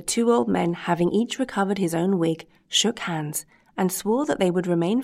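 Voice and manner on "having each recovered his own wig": ordinary delivery, nothing marked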